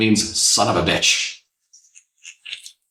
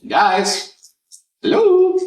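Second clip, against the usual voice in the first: calling out; 12 semitones higher, much slower than usual. Retold or imitated. Abandoned in Paris, calling for her group, rising panic. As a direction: call for lost friends, climbing higher. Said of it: "And I was like".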